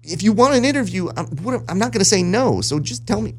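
high, eager retold answer; mock eager reply. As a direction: sound keen to say yes. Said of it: "I'm like".

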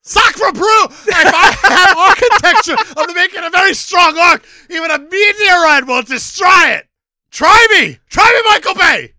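put-on loud, high French accent shouting; french chef. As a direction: bellow it in a French accent at Michael Bay. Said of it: "Absolutely nailed it".

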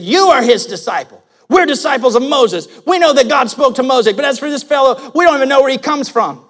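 loud, scornful retort; hurling insults. Acted. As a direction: throw it at him like an insult. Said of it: "they hurled insults at him".

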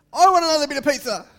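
put-on high, loud, fast rude kid's demand; greedy bratty kid voice; demanding child. Shown as a demonstration. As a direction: snap it like a spoiled child. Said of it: "the difference in my face too".

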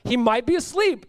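high mock suggestion; taunting. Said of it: "He's just calling them out".

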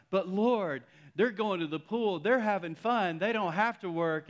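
high, loud mock whine to God; complaining prayer. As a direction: protest how unfair it is, hammed up. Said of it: "we start to just complain".

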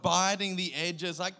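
usual speaking voice

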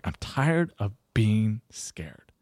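very slow, hushed near-whisper; tearful confession. Retold or imitated. Lawyer in tears admitting fear to coach, hushed confession. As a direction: imitate a man breaking down, barely voiced. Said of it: "Starts crying".

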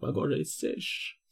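dry, rough throat; hoarse voice. Real, not acted. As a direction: speak through a parched throat. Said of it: "vous allez entendre ma voix rauque".

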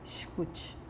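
softly whispered word. Acted. Guided meditation, breathed instruction.

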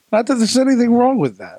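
high, loud Seinfeld catchphrase; Seinfeld impression. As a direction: deliver it like Seinfeld's sitcom line.

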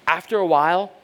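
ordinary talk, nothing marked

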